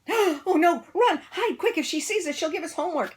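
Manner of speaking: high, loud panicked whisper-shout; panicked kids. A narrator's reading. Kids spotting Miss Swamp, high panic. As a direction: flee in a panic. Kids flee witchy teacher.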